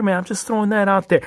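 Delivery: ordinary talk, nothing marked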